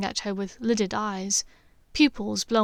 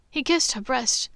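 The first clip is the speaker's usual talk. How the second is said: ordinary delivery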